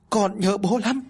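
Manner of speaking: put-on high, loud child's wail to his dead father; child crying loudly. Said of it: "Nó lao vào bàn thờ của bố mà khóc lớn".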